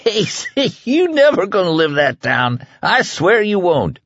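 high, laughing tease; bursting into laughter. A narrator's reading. Man swears Ace will never live it down, laughing. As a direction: squeeze the words out through laughter. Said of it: "he burst into laughter again".

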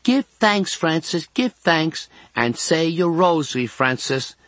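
high girlish mock of a nun, not truly whispered; Patricia. A narrator's reading. Girl secretly mimicking nun to boy, mocking. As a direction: mimic the nun's admonishment, pitched well up. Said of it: "Then Patricia whispers".

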